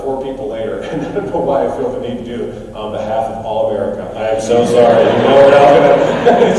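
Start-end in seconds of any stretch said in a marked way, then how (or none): 4.2-6.5 loud overdone sorry; hammed-up apology. Speaker to the clerk on behalf of America, loud mock apology. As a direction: apologise far too big.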